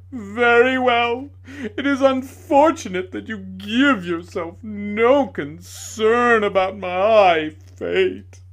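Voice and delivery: high, loud, slow melodramatic ranting; raging tragedian. A narrator's reading. Tragedian ranting at the lady who laughed, loud and slow. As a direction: declaim your wounded pride like a ham actor.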